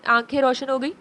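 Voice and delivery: plain reading voice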